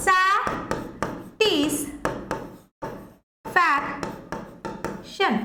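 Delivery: very slow, split into syllables. Shown as a demonstration. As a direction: stretch it out syllable by syllable. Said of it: "তাহলে দেখো".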